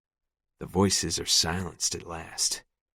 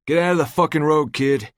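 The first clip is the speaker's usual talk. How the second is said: furious yell, much higher and louder; angry yell; 9 semitones higher, 7 dB louder than usual. A narrator's reading. Driver yelling at the boy in the road, angry. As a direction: yell at the kid from the car. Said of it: "an angry voice yells".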